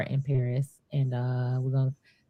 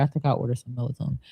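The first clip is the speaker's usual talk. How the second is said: usual speaking voice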